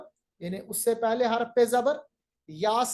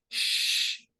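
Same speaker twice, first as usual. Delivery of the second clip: shushing sound; much slower than usual. Shown as a demonstration. Teacher hushes a noisy classroom with shh. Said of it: "class में हम कहते हैं".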